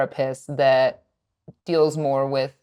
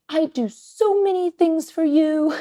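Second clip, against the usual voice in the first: high, slow imitation of a whiny toddler; 12 semitones higher, slower than usual. Mother mimicking her son's complaint in a high toddler voice. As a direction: mimic the little boy, drawn out and high. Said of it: "he went".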